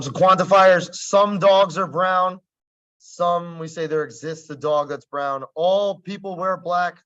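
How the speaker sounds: normal talking voice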